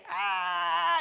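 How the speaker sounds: high, held "ah" of a tantrum; father imitating a child past the tipping point, high mock wail; child's mock wail. Imitated high-pitched crying out.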